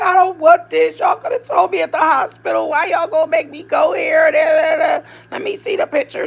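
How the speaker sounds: high, loud imitated upset; mock tearful protest. Retelling pranked mother refusing a gift, mock tearful protest. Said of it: "Crying and everything. And then she's like".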